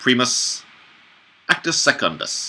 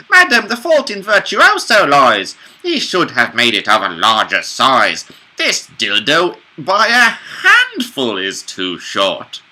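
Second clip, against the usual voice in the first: high character voice; 5 semitones higher, 6 dB louder than usual. Acted. Officina's line read again.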